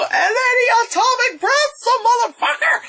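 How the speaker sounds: high-pitched, cracking hyped yell; thrilled fan shouting the big moment; excited shout. Real, not acted. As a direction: yell it like the best moment of the movie. Excited retelling of climax.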